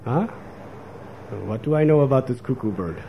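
ordinary talk, nothing marked

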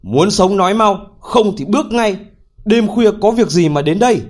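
a threatening midnight shout; shouted command. A narrator's reading. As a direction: bark it out as a threat. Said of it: "Anh ta lại quát".

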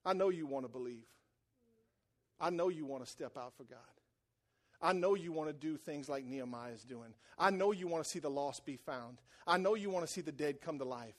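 low, softened pastoral voice; tender reassurance. Real, not acted. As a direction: gently let them know you understand. Reassures congregation after the joking.

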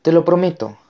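high, loud promise; grito. A narrator's reading. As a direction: promise it in an urgent cry. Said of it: "gritó a su vez Connor". (promesa a gritos, aguda)

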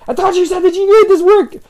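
high, loud exasperated complaint; high exasperated whine. Retold or imitated. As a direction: whine it in a squeaky accusing voice. Acted quote, annoyed boss.

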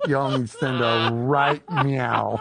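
slow drawn-out words; slow motion talk. Pretend. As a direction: stretch every word out. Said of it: "when we talk slower".

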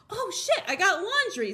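high, startled "oops" reaction; comic alarm with a laugh in it; acted sudden realization. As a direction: remember it with a mock jolt, pitched high. Acting out sudden remembering.

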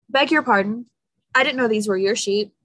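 ordinary delivery

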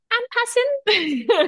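squeaked out high and slow, breaking into a laugh; high, drawn-out giggling word. Real, not acted. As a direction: let the word dissolve into laughter. Laughing at her own point.

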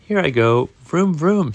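loud, high vroom-vroom; playful kid revving up, no real giggle; giggly glee. A narrator's reading. Daniel sets off pedalling, playful high glee.

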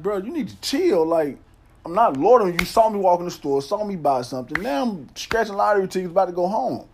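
heated pleading. Retold or imitated. Arguing with officer.

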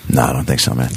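low-pitched mock turndown; retold reluctant refusal. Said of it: "And I was kinda paused".

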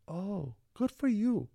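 high, slow, condescending "good for you"; mock praise. Old teacher talking down to his former student, slow and condescending. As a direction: drag it out like a patronizing pat on the head. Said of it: "He's like".

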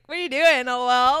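loud incredulous cousin; loud mock question. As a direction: call it out, teasing. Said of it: "He's like".

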